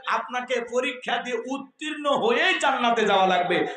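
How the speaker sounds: ordinary talk, nothing marked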